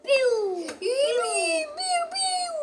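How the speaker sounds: rising pew pew; web shooting sound. Pretend. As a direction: shoot webs like Spider-Man. Pretend superhero shooting webs.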